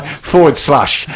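slow Northern Irish forward slash; Northern Ireland accent. Pretend. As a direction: say it like a Belfast local. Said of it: "I was doing that in a Northern Ireland accent".

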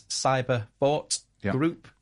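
deliberate announcer read; slow and clear. Shown as a demonstration. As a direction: spell out the web address slowly. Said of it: "give us a shout out again for your website".

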